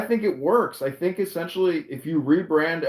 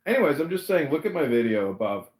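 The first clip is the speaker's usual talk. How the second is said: ordinary talk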